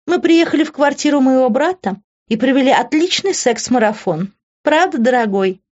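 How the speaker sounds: high-pitched, smug taunting; mocking, self-satisfied woman; taunting schemer Ivy. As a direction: needle them sweetly in a high voice.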